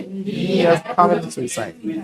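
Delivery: very slow, every syllable stretched; teacher stretching the sentence for students; slow drawn-out demo. Shown as a demonstration. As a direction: spell out He has committed suicide slowly, word by word. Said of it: "Can you feel the difference?".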